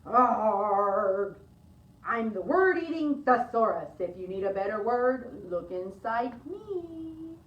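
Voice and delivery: loud monster growl to open, then slow; word-eating thesaurus. Acted. Kids in costume greeting librarians as a growling thesaurus. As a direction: growl like a friendly book monster. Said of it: "some other creatures who would like to say hello".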